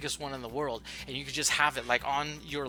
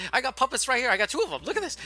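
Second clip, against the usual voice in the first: excited showoff; 8 dB louder than usual. Acted. Showing off puppets excitedly.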